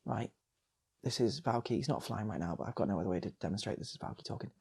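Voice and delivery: ordinary delivery, nothing marked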